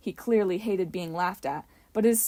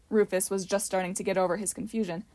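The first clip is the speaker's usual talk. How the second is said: ordinary delivery, nothing marked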